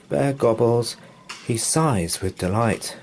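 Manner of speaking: plain reading voice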